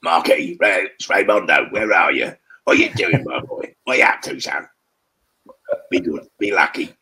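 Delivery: a hint of a Cockney mate calling out; loud matey London greeting; Ray Winstone impression. Actor doing his mate Ray calling down the phone, lightly Cockney. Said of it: "I actually use that voice".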